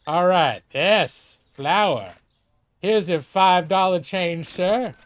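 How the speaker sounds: mild country clerk twang; folksy cashier dialect. Acted. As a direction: give the change with a homey twang. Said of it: "I didn't used to have that, uh, dialect".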